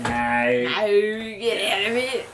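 broad ocker Aussie twang; bogan voice. Acted. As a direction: switch to a broad bogan accent. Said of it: "my bogan voice".

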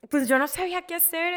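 ordinary delivery

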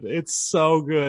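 high, slow delight on so good; excited, drawn out. Real, not acted. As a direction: savor each word, gushing. Raving about air fried Twinkies.

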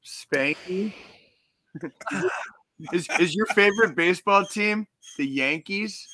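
high, slow teasing voice; put-on high voice for a joke; mock voice. Mocking sexual innuendo voice.

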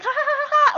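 high, loud fake cackle imitating her; mock loud laugh. Roasting the loud girl on the train, with a high, loud mock laugh. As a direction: mimic her showy fake laugh, hammed-up.

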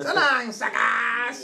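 high cackling villain taunt; gleeful cartoon villain, laughing through it; Skeletor voice. Skeletor insurance commercial catchphrase.

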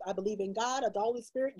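normal talking voice

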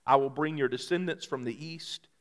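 soft, measured reading of scripture. Acted. Pastor reads scripture softly to the congregation. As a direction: read the verses gently to the church.

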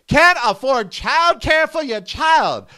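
ordinary talk

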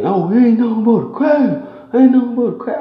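sing-song the insult at him to belittle him; loud capping. Retold or imitated. The other guy capping loudly at the narrator outside, high mocking taunt. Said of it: "wanna goddamn start loud capping".

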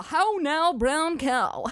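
a theatrical warm-up before a retake; mock vocal warm-up. Shown as a demonstration. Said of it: "let's take that from the fucking top".

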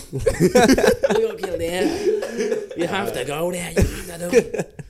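high, loud Irish impression through laughter; hammed-up Irish voice, drawn out and laughing; mock Irish accent. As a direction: play up a silly Irish accent. Mock Irish voice, continuing the joke.

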